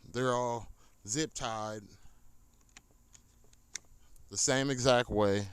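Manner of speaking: normal talking voice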